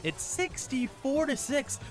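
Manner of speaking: high, drawn-out disbelief at the score; amazed score read. Real, not acted. Amazed by a lopsided halftime score.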